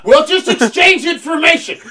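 loud, high, hammed-up Russian accent; terrible Russian accent. Captain after a sub collision, shouting in bad Russian accent. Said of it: "That's a terrible Russian accent".

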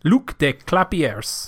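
slow French pronunciation of the name; French accent. Pretend. Repeating the name for a co-host, French accent. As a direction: give it a drawn-out French accent. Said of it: "That was a good French accent".

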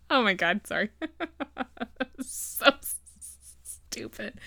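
helpless real laughter breaking up the words; cracking up. Real, not acted. Teller cracks up at own silly reveal, laughing through words. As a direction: laugh through it, barely finishing.

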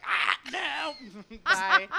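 mock panic. Fleeing a ghost, acted quote.

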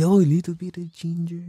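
lightly voiced Filipino uncle, soft; a touch of Filipino lilt on "nitu bit"; Filipino accent. Uncle naming ginger, light Filipino accent. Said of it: "can't I not do Filipino accent".

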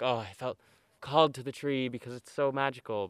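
mock dreamy. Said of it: "I can try to say it's some fancy reason".

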